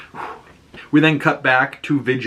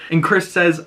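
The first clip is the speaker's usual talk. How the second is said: usual speaking voice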